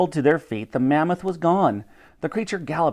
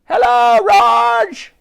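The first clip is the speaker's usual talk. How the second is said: high, loud hail; called-out greeting; 9 semitones higher, 7 dB louder, much slower than usual. A narrator's reading. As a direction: call out to a friend across the street. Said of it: "She called out to him".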